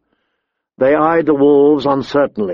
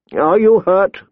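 raised-pitch, anxious query; concerned question; 6 semitones higher than usual. A narrator's reading. Maester coming to the injured boy, concerned.

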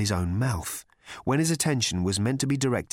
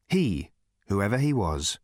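plain reading voice

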